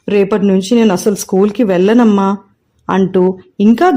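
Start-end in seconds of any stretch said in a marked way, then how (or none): none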